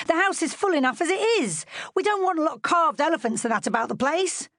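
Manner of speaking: a no-nonsense nanny setting him straight; firm nurse. A narrator's reading. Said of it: "Nana broke in firmly".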